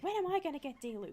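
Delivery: high, loud whiny complaint; loud high mock wail; upset friend. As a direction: whine it like a sulking friend. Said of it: "really upset".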